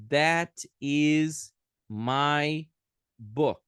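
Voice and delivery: very slow, THAT stretched and stressed; slow demo. Shown as a demonstration. Teacher slowly modelling the English sentence. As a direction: model it slowly so they hear each sound.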